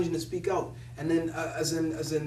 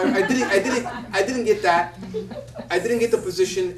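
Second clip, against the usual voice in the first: laughing while talking, louder; 3 semitones higher, 6 dB louder than usual. Real, not acted. Telling of the rejection while laughing. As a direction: chuckle through the words.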